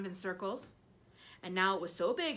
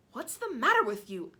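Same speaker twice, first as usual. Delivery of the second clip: a lightly voiced bossy aunt demanding; 4 semitones higher, 8 dB louder than usual. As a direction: snap at her sister, a little raised. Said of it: "Aunt Sponge demanded".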